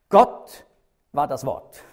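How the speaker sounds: fronted "Gott" hit hard, Greek word order; stress on Gott. Shown as a demonstration. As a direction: open with a punched "Gott". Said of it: "Das ist extra umgedreht".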